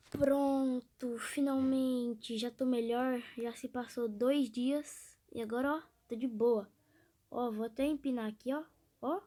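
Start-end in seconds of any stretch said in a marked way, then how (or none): none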